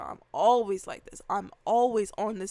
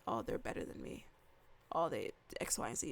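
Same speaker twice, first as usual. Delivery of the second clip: hushed, much lower, self-doubting; whispered inner thought; 12 semitones lower, 11 dB quieter, faster than usual. Retold or imitated. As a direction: murmur it to yourself like an old insecurity. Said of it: "and I still was thinking".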